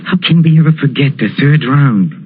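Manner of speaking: ordinary talk, nothing marked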